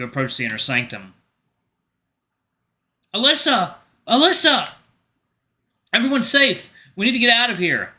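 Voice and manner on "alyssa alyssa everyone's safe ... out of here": high, loud desperate shout; hollering. Acted. You shout for the rabbit sage to flee, loud holler. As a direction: call her name with burning lungs. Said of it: "You hollered as loud as your burning lungs allowed".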